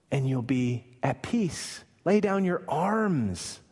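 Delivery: high, pleading preacher's appeal, slowing on key lines; preaching at full force. Acted. Preacher urging the congregation to accept amnesty, preaching at full force. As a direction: urge the listener to surrender to God.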